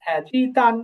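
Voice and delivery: usual speaking voice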